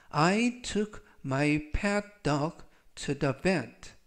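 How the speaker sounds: "I took my pet dog to the vet" read very slowly, word by word; slow model reading. Shown as a demonstration. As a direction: lay each word out slowly. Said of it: "즉 전체적 의미는".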